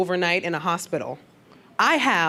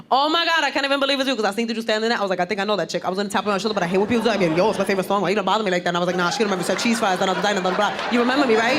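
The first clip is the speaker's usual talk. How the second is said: put-on chattering old acquaintance; much faster than usual. Girl catching her on the bus, nonstop gushing chatter. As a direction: rattle on without a gap in a Bronx girl's voice. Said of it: "This is what I heard from behind me".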